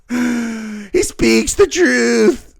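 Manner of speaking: very slow, drawn-out mock wisdom; mock solemn quote. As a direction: savour each word as a joke. Replaying the girl's line mockingly.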